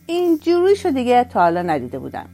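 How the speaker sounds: mock him loudly for the others. Taxi driver mocking the old man to colleagues, loud jeer. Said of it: "با لحنی لوده و تمسخرآمیز و با صدای بلند گفت".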